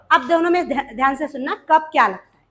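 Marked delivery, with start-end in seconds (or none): none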